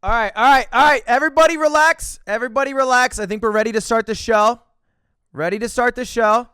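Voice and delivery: loud, high emcee call to the room; show host announcer. Acted. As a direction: open the show like a game show host.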